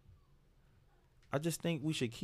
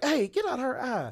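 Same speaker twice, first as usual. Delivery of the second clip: mock call-out; 14 semitones higher, 3 dB louder than usual. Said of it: "I'm about to be like".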